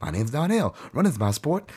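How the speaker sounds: high mock Donnell catchphrase; high mock impression of a TV character. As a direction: imitate the Baywatch kid. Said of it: "I hear that character".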